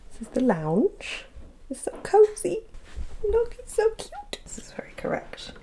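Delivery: soft whisper, cute and hushed; hushed house-tour whisper. Acted. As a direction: whisper it like showing off a secret room.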